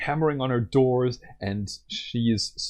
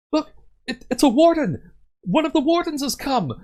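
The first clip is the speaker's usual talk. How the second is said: high, much louder cry of relief; excited villager; 7 dB louder than usual. Acted. A townsperson spotting the warden, crying out high and loud. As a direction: shout out that help has arrived. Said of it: "will turn and say".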